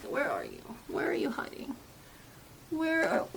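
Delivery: hushed muttering while searching; whispering to herself. Real, not acted. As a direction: whisper it under your breath. Hunting for the lipstick.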